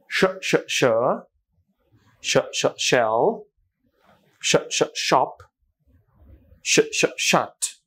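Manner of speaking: slow, drawn-out শ sound before shell, shop, shut; slow sound drill. Shown as a demonstration. Teacher drilling the শ sound for students, slow and drawn out. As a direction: hiss শ, শ then say the word slowly. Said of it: "আমার sound শুনবেন".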